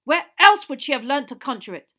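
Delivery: loud, high angry question; shouted challenge. A narrator's reading. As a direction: demand an answer furiously. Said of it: "shouted Mister Crouch".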